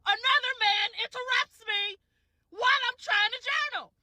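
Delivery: high-pitched, indignant wail; exasperated outburst. Real, not acted. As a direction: pitch it way up in disbelief. Indignant at being interrupted.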